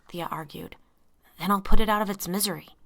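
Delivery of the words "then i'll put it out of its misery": loud sharp snap; snapped. A narrator's reading. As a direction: bite the words off loudly. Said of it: "Andy snapped".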